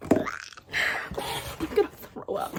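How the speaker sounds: slow, breathy whisper of nerves; breathless whisper. Real, not acted. Reader opening her long-awaited book, slow whisper. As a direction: barely breathe it out, overwhelmed.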